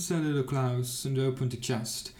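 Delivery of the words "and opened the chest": ordinary delivery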